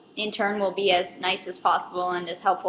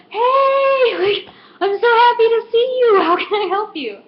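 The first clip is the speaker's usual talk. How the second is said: much higher, louder customer-service cheer; happy greeting; 12 semitones higher, 6 dB louder than usual. Retold or imitated. Clerk greeting a favourite customer, much higher excited cheer. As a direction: beam at them like a thrilled shop assistant. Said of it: "I'm like".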